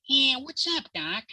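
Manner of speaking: high Brooklyn rabbit voice; Brooklyn accent. Retold or imitated. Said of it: "kinda like a Brooklyn accent".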